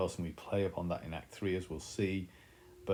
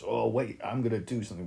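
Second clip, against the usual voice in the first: mock blustering threat, a little raised; half-hearted threat from someone powerless; empty threat; 4 semitones higher, 5 dB louder than usual. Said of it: "It's that horrible empty threat of somebody".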